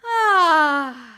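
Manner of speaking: loud open sighing ah on the exhale; big sigh. Shown as a demonstration. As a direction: release a long, loud sigh. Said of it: "we're just gonna do that again".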